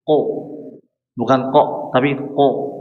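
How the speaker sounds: ordinary delivery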